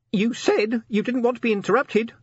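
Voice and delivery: high defensive little voice; a tiny imp defending itself. A narrator's reading. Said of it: "said the imp defensively".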